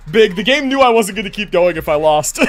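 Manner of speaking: ordinary talk, nothing marked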